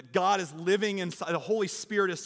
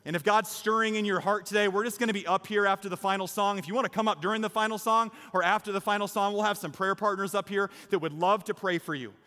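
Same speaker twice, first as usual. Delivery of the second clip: rousing, emphatic sermon climax; impassioned preaching; 4 semitones higher, 5 dB louder than usual. Real, not acted. Preacher urges congregation toward baptism, impassioned preaching. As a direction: drive the appeal home to the whole room. Preacher builds to closing appeal.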